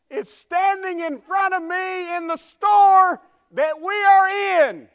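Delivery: put-on high, very slow, booming child's yell; shouting little boy. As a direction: broadcast it to the whole store. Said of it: "he said quite loudly".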